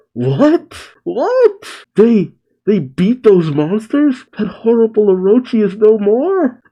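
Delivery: acted astonished questions, pitch leaping up; disbelieving shout. Townspeople reacting to the monsters' defeat, disbelieving shout. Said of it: "And the people shout".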